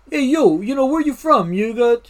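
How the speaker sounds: New York accent. Shown as a demonstration. Firefighter showing how the locals talked, in a New York accent. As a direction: put on a brash New York street accent. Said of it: "talk with their New York accent".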